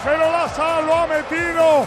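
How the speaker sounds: shouted play-by-play over music; breakneck goal commentary. Real, not acted. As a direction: call the goal replay at full force. (narración de gol a grito pelado)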